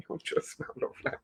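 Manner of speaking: hushed, voiceless aside; whispered. Real, not acted. Host whispering to viewers how lovely the freshener smells, whispered. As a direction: whisper it quickly. Repeats the line under breath.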